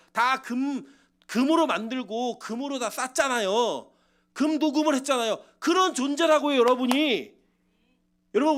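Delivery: emphatic, exhorting sermon delivery; impassioned preaching. Acted. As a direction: drive each phrase home to the congregation. Preaches at full force.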